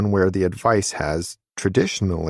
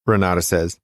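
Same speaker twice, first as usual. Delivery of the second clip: plain reading voice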